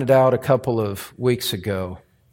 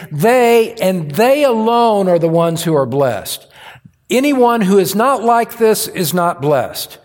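emphatic, raised pulpit declaration; forceful preaching; 7 semitones higher, 4 dB louder than usual. Real, not acted. Preacher on who alone is blessed, forceful preaching. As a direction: drive each clause home at a higher pitch.

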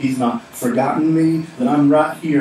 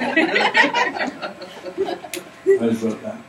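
laughing; much slower than usual. Real, not acted. Laughs at own made-up song.

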